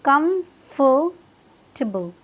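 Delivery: कंफर्टेबल slowly as kum - fuh - tuh-bl; very slow model कंफर्टेबल with a weak ending; exact pronunciation, broken up. Shown as a demonstration. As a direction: pause between parts of कंफर्टेबल, no "table". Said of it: "क्या है exact pronunciation".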